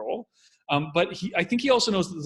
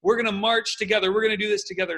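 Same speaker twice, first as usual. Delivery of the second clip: higher and louder, rallying the crowd; 7 semitones higher, 6 dB louder than usual. Retold or imitated. Commentator imitating Obama rallying the crowd, louder. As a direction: pump up the audience with rising energy. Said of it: "He starts speaking louder and saying".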